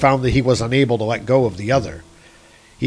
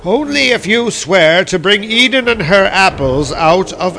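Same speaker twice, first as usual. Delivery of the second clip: high, louder bird voice, slowing at the end; eagle's demand; 6 semitones higher, 4 dB louder than usual. A narrator's reading. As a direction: spell out the price like a cunning eagle. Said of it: "said the eagle".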